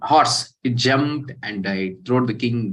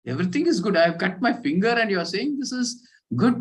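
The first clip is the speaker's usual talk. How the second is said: pitched well higher, mock indignant outburst; angry king; 10 semitones higher than usual. King snaps at minister over cut finger, higher indignant. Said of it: "This king became very angry".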